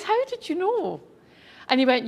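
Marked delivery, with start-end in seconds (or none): 0.0-1.0 amazed. Retold or imitated. Asking the stranger, amazed.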